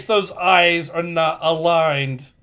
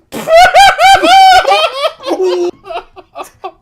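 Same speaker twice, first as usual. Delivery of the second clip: shrieking laugh; 14 dB louder than usual. Real, not acted. Shocked at hostage joke.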